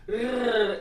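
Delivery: a light, slow "Rrr" with a small laugh; soft rolled R sound. Shown as a demonstration. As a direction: roll a weak R, lightly. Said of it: "Um R bem fraquinho".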